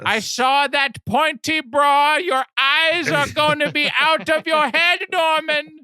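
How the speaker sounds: hammed-up accusing mother in falsetto; Norma Bates. Mother accusing her son of ogling, screeching old lady. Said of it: "you just hear over the P A".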